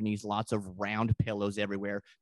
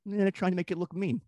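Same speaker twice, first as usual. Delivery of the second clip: De Niro impression; 8 semitones higher, faster than usual. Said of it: "the Robert De Niro character goes".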